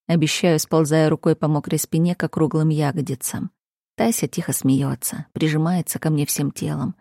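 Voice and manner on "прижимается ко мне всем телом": plain reading voice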